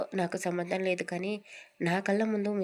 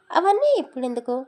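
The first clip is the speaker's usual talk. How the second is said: higher and louder dismissive burst; brushing it off; 8 semitones higher, 8 dB louder than usual. Acted. Mother cuts off son's question, loud and dismissive. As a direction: wave the question away sharply.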